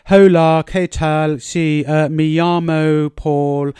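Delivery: put-on slow, careful Spanish in a heavy English accent; plodding textbook Spanish with a thick English accent; mock English accent in Spanish. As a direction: sound like a stiff English learner of Spanish. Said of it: "basically speak like".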